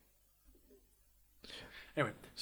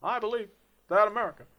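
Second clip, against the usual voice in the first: higher, airy Obama cadence, louder on the end; Obama impression; 9 semitones higher, 4 dB louder than usual. Doing Obama for his podcast co-host, airy. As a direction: lift into a measured Obama speech voice. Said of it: "Obama was in some ways like that as well".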